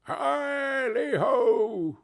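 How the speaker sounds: very slow, high, drawn-out whoop; merry shout. Acted. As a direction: whoop like a joyful old master. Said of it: "cried old Fezziwig".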